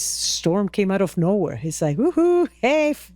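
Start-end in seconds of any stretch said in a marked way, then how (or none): 2.0-3.1 high-pitched mock whoop. Storm taunting the climbers out of nowhere, mock whooping. Mimicking the storm's sudden arrival.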